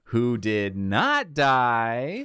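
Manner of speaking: hammed-up slow build on "did not die"; Muppet inflection. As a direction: stretch out "who did not die" for comic drama. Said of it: "the inflection, he goes".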